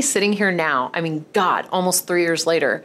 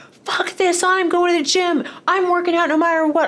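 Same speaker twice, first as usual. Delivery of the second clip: much higher, mock-stubborn voice of her past self; 13 semitones higher than usual. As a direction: play her old pig-headed self, cartoonishly high. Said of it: "you should have seen me. I looked like an idiot".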